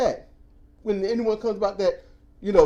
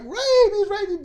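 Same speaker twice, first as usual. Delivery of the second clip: mock hollering; 5 dB louder than usual. Man yelling for help at the roadside, mock holler. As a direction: holler for help half-jokingly. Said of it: "he's hollering".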